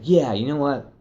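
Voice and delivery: retold burst of enthusiasm; stoked. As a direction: get fired up, then decide on the spot. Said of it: "he's getting all stoked about it".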